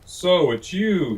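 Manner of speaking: high, loud, slow roar; lion roars. Acted. Woken lion roars at the mouse, loud and slow. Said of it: "he roared".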